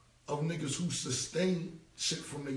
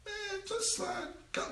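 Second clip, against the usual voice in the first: mock congratulations. Mocking the other rapper's story.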